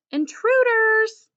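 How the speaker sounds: high, loud alarmed dog yell; barking shout. A narrator's reading. Dog barking at visitors at the door, loud alarm. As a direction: sound the alarm at the intruders. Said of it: "I bark".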